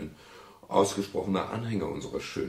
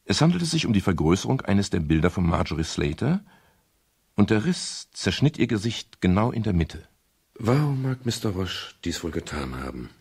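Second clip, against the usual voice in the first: even, measured first-person narration; story narration; 5 dB louder than usual. Acted. Detective narrating the search of the flat, calm and measured. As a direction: tell it like a detective's memoir.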